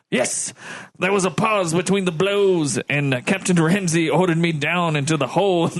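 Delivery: formal officer giving testimony; a hint of stiff naval officer; lightly voiced ship's lieutenant. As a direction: read it out as a lightly acted witness statement. Reads lieutenant's testimony in role.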